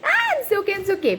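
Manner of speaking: high, mock-crazed childish yelling; mock yelling toddler. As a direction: imitate the toddler shouting over the parents. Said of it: "Ele começa a gritar igual louco".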